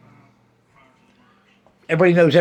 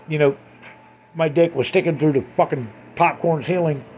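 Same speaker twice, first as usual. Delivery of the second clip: ordinary talk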